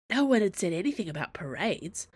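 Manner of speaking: ordinary delivery, nothing marked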